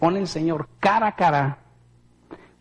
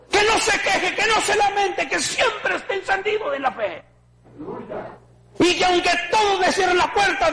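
high, ringing preacher's cry over the congregation; preaching at full force. Acted. Preacher calling his church to shine, preaching at full force.

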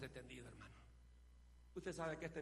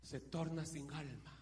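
preacher building to a roar; fiery preaching; 19 dB louder than usual. Acted. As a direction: preach it with rising fire. Preaching a sermon. (predicación ardiente)

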